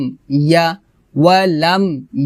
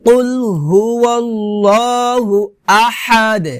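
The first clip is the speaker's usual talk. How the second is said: higher, slow held recitation; Quran recitation; 3 semitones higher than usual. Shown as a demonstration. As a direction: chant the verse with long level vowels. Model recitation of the verse.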